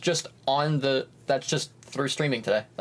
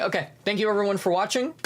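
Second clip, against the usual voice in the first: ordinary delivery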